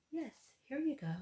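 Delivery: high, light here you go; mock easy answer. Pretend employee handing over pay stubs, breezy. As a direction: play it breezy and simple.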